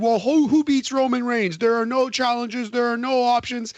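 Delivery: high, insistent mock complaint; imitating complainers. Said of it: "to the people who said".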